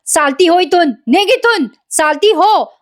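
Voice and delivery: high-pitched dramatic dismissal; mock yelled order. Sayali throws Priya out, high mock yell. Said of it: "Sayali मोठ्या मोठ्याने ओरडत असते".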